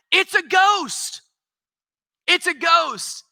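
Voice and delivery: high frightened cry of a ghost sighting; mock terrified cry. Preacher voicing terrified disciples crying ghost. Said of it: "they cried out".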